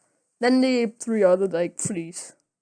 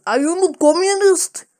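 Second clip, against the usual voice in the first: mock Russian man; 4 semitones higher, 5 dB louder than usual. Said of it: "the Russian man says".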